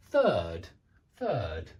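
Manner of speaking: "third" low, voiceless th, falling; careful demo; model word. Shown as a demonstration. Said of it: "rhyme with other words like".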